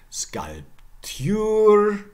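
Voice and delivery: "sculpture" as skulp-TYOOR; high, very slow "sculpture", loud ending; Middle English pronunciation. Shown as a demonstration. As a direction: stress the end of "sculpture", y-glide. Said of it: "more examples".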